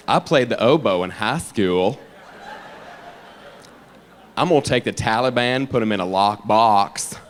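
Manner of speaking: low, stiff politician impression; Al Gore. Politician boasting about the Taliban, low deadpan. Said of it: "I'm Al Gore".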